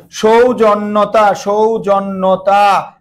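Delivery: slow, drawn-out words, the wrong form held up; slow questioning drawl. Shown as a demonstration. Teacher drawls the wrong word for students, slow questioning. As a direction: stretch out সৌজন্যতা slowly, as if questioning it. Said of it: "teacher drawls the wrong word".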